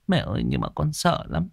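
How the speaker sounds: soft, scared confession; a touch of fear, said quietly to mother; frightened. Acted. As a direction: daughter quietly admitting her fear to her mother. Frightened daughter confides in mother.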